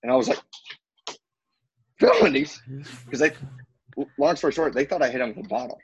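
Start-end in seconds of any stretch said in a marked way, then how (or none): 2.0-2.6 high, stunned one-word echo; mock shock. Prisoner learning of felonies from guard, acted shock. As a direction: pitch leaps up in disbelief.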